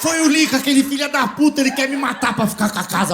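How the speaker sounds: high, hammed-up outraged mother; frantic accusation. Mother frantically blames her son. (mãe acusando o filho, exagerada)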